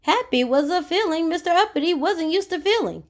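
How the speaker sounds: ordinary delivery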